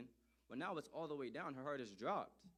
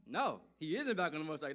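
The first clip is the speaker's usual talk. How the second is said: emphatic denial; 3 semitones higher, 10 dB louder than usual. Acted.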